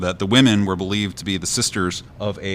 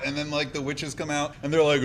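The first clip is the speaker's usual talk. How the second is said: usual speaking voice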